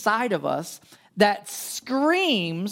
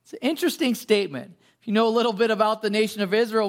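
normal talking voice

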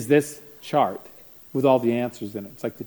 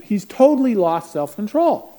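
high, loud, slow panic; a mother at her wit's end; panicked mom; 8 semitones higher, 9 dB louder, slower than usual. Retold or imitated. Mom telling the counselor about her son, panicked. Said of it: "in a panic".

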